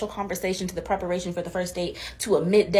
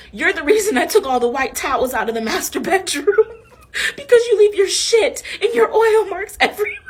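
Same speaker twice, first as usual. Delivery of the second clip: cracking up mid-quote, getting higher; breaking into laughter while telling it; laugh-filled, rising to a howl; 10 semitones higher, 4 dB louder than usual. Real, not acted.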